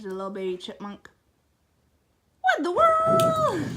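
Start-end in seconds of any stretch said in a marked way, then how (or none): 2.4-3.6 high loud shout of surprise; surprised shout. Real, not acted. Owner shouting in surprise at the dog. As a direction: shout in amazement.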